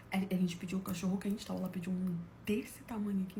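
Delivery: softly whispered aside; hushed storytelling aside. Real, not acted. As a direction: drop to a whisper as if sharing a secret.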